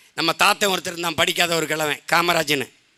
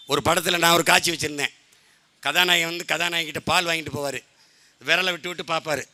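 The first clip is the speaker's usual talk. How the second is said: normal talking voice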